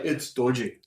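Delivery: quick imitation of a thick Scottish accent. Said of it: "So he'd be like".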